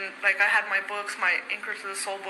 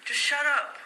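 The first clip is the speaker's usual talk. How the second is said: snapping; 7 semitones higher than usual. Retold or imitated. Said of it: "I'm like".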